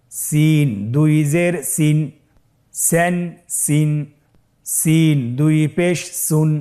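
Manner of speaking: slow, drawn-out level chant; measured letter-by-letter chanting; recitation drill. Shown as a demonstration. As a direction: lead learners through each syllable, holding the vowels. Leads a spelling drill.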